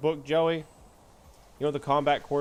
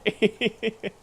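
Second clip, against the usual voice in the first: high, louder burst of laughter; real laugh; 8 semitones higher, 5 dB louder than usual. Real, not acted. Streamer amused by own teasing, high laugh. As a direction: crack up at your own line.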